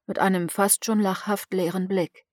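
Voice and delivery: usual speaking voice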